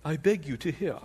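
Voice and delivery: lightly voiced British orator, hushed; British accent. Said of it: "You can almost see this in a British accent".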